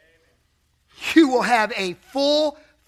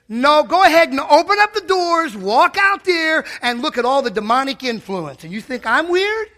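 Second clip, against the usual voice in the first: higher, louder challenge; preaching at full force; 5 semitones higher, 6 dB louder than usual. Acted. Preacher answering the scoffer, loud and high. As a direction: fling it back at the doubters.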